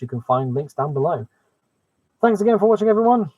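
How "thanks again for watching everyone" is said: cheerful sign-off. Real, not acted.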